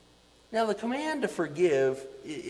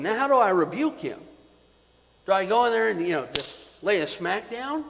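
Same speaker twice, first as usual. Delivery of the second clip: ordinary talk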